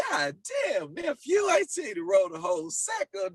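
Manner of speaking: high, exclaiming rapper imitation; mock outcry in an imitated rapper's high voice. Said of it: "He come back like".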